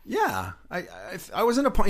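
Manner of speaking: high, loud and eager; breathless keen young actor pleading his case; eager pitch. Retold or imitated. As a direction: rush to sell yourself for the part. Eagerly pitching himself to boss.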